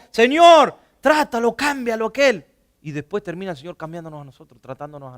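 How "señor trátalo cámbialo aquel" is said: loud, pushy plea to the Lord; demanding prayer. Retold or imitated. Believers loudly demanding God change someone else. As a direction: cry out at God to fix the other one. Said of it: "oramos por alguien y decimos".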